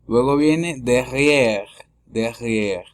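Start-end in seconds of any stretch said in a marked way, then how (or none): 0.8-1.7 slow "derrière", ending on the R; silent final E. Shown as a demonstration. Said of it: "La E es muda al final". 2.0-2.8 "derrière" ending on the R; silent final E. Shown as a demonstration. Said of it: "La E es muda al final".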